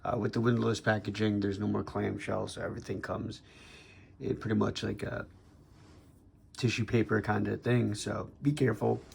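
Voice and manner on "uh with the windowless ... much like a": ordinary talk, nothing marked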